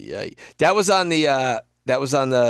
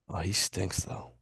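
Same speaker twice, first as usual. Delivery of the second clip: low, quiet, deadpan verdict; dead serious; 8 semitones lower, 13 dB quieter, slower than usual. Real, not acted. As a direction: say it with zero intent of comedy. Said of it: "I like how serious you said".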